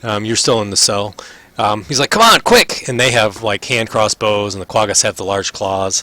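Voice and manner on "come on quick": high, loud hurrying cry; frantic high-pitched urging; urgent shout. Acted. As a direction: shout for backup right now. Ront urging the others on.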